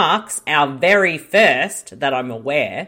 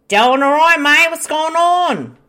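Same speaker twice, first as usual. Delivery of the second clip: loud, high hammed-up Aussie ocker; 12 semitones higher, 7 dB louder than usual. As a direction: belt it out like a cartoon Aussie bloke. Host answers in ocker voice.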